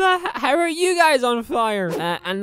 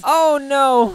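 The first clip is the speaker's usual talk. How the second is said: ordinary delivery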